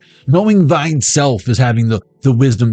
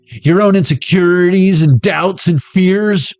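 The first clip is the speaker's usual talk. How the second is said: higher, slower theatrical list; mock-grand sermon rising; dramatic build; 7 semitones higher, much slower than usual.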